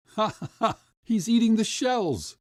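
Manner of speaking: high mocking laughter; laughing crowd. A narrator's reading. Crowd laughing at the boy eating shells. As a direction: laugh at the boy's mistake. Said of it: "laughed the crowd".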